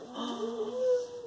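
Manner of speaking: high sharp gasp of surprise; theatrical gasp. Acted. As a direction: gasp to thrill the child. Gasp at story moment.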